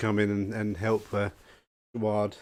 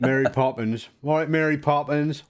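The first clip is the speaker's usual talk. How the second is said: lightly voiced Cockney, a bit higher; mock London accent; 4 semitones higher, 3 dB louder than usual. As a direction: tease him in a hammed-up London voice. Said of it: "How you doing, Mary Poppins?".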